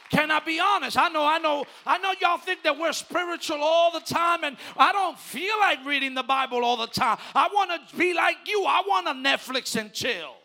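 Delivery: preaching at full force. Acted. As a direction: preach it out loud to the whole church. Preacher builds to shouting climax.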